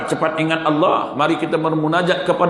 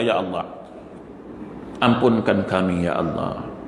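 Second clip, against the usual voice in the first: pleading prayer; 3 semitones lower than usual. Acted. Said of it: "ngaku pada Allah".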